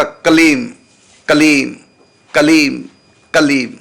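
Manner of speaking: कलीम repeated with a closed final m; कलीम, कलीम, short clear drills with a half m; repeated pronunciation demo. Shown as a demonstration. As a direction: say कलीम over and over, lips shut on the m. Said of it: "इस प्रकार से आप बोलेंगे".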